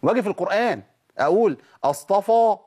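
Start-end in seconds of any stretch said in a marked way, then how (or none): 1.8-2.5 أصطفى with a thick heavy fa. Shown as a demonstration. Teacher shows the wrong heavy f. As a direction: darken the fa in أصطفى. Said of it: "مفيش فا".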